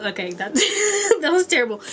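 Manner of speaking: breaking up with real laughter; laughing. Real, not acted. Reader judging her own terrible riff, laughing. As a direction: laugh at your own flop.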